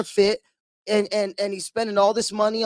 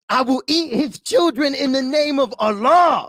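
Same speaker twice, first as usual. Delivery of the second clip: higher, lisping boxer's shout; Mike Tyson; 5 semitones higher, 4 dB louder than usual. Retold or imitated. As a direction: imitate a boxer's wild boast, building to a shout. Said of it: "Tyson goes like this".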